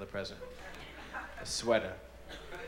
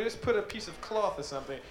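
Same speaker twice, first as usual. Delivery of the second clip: ordinary talk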